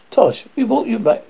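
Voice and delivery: ordinary delivery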